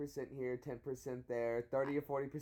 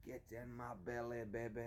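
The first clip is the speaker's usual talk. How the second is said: softer mock Scottish voice; Scottish accent; 8 dB quieter than usual. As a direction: quietly imitate a Scottish film character. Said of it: "Try a Scottish accent".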